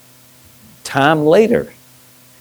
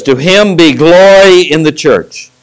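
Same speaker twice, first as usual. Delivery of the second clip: proclaiming scripture; 5 semitones higher, 5 dB louder than usual. Acted. Preacher quotes scripture.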